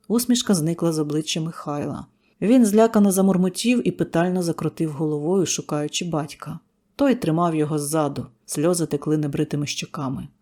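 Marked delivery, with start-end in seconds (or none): none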